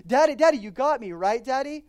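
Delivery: put-on a small boy begging his daddy for reassurance; anxious little boy.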